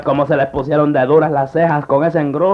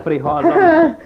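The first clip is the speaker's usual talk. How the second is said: chillido alarmado; grito de susto agudo; 10 semitones higher, slower than usual. Acted. Grito al ver el golpe. (grito agudo)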